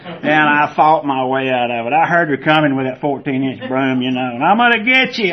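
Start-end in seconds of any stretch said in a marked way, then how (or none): 4.4-5.3 threatening granny. Retold or imitated. Grandmother chasing with a broom.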